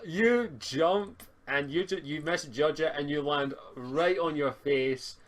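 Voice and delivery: ordinary delivery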